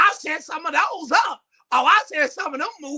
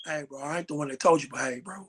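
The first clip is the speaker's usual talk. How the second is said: very low, soft informant; deep voice; 12 semitones lower, 7 dB quieter than usual. Acted. Friend breaking gossip to his bro, deep confiding voice. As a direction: drop deep and keep it hushed.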